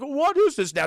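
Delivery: retold surprised Santa; puzzled Santa. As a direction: act out a startled question. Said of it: "He's like".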